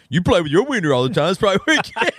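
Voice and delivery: a light imitation of the wife teasing; wife's teasing retort. Telling friends how the wife teased him, lightly voiced. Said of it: "what does she say immediately".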